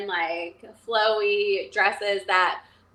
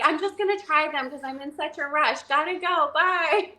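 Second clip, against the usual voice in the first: high, rising brush-off with a singsong bye; acted hasty excuse; 6 semitones higher than usual. Daughter in a rush escaping mom, acted high excuse. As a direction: make a mock getaway, pitched up.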